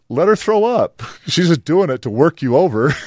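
a hint of a fed-up husband brushing it off; mock exasperated dad. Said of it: "I'm like".

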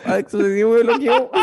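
high, laughing repeat of the punchline; laughing disbelief. Real, not acted. Storyteller laughing about getting blocked by his match, high and amused. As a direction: say it again, cracking up at your own bad luck.

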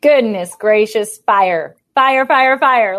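startled, excited shout of fire; excited exclamation. Real, not acted. Reacts to passing fire truck.

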